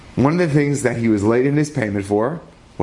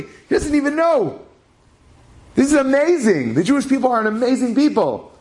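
high, excited and awestruck, building higher; amazed exclamation; 9 semitones higher than usual. Retold or imitated. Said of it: "He says".

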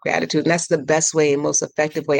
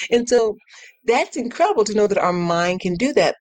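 ordinary talk, nothing marked

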